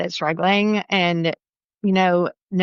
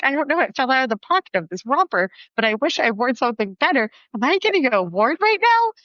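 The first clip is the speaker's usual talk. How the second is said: panicked excitement; 6 semitones higher than usual. Retold or imitated. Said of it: "I was like".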